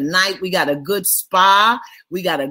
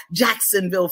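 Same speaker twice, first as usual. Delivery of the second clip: ordinary delivery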